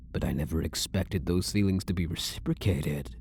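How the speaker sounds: ordinary talk, nothing marked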